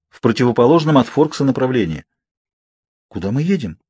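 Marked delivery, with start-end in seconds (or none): 3.1-3.8 mild unease in the question; worried question. A narrator's reading. Girl to the silent Cullens in the car, worried. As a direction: ask with understated concern. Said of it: "обеспокоенно спросила я".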